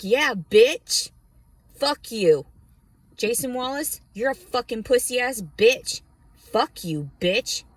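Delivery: loud, high cussing out; defiant shout. Retold or imitated. Telling off Jason Wallace, a loud defiant shout. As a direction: yell it right at him. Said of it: "And I'm just like".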